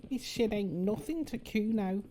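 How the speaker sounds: high-pitched put-on accent; Kuno impression. Player mimicking a game character, put-on high accented voice. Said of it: "That was a flawless accent".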